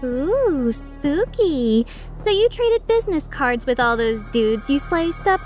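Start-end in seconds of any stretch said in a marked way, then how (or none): 0.0-1.8 drawn-out, swooping teasing; mock awe. Mercenary needling a solemn rival swordsman, mock-spooked. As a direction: playfully pretend to be spooked. Mocks the swordsman's grave threat.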